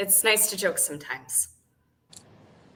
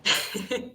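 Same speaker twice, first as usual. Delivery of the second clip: short breathy chuckle; light chuckle. Acted. Caller amused by marker color preferences, light chuckle. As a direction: chuckle briefly under the breath.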